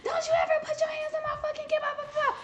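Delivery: high mock screech of a furious mom; angry mom. Mom yelling at the man who caught her daughter, in a high mock screech. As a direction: play the outraged soccer mom, up high. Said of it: "here come the mom".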